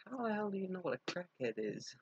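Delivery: high, incredulous retold question; mock-shocked at the kid knowing that word; shocked disbelief. To her young cousin, amazed she knows the word crackhead, high and incredulous. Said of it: "I'm like".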